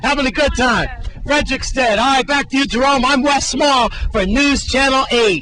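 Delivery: loud, upbeat TV reporter wrap-up; reporter sign-off. Acted. Reporter hands back to Jerome, loud upbeat sign-off. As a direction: wrap the segment with big on-air energy.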